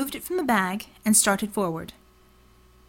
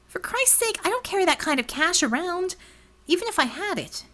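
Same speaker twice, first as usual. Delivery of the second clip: higher, exasperated protest; pitched-up exasperated outburst; 8 semitones higher than usual. Acted. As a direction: throw up your hands at the price. Ramona balks at the price.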